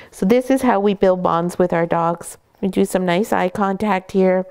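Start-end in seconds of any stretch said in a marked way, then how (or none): none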